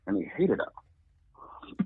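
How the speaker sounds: slow whispered aside; storyteller hushes to stress the abuser's hatred; hushed aside. Real, not acted. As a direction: drop to a slow whisper for the dark detail.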